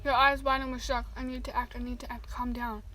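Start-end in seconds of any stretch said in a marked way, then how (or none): 1.2-2.8 soft, hushed self-talk; whispered inner voice telling herself to stay calm; anxious inner whisper. Acted.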